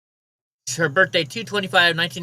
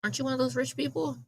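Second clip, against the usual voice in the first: higher, faster sarcastic jab; mocking question; 8 semitones higher, 5 dB quieter, faster than usual. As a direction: sneer the question back. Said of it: "And I'm like".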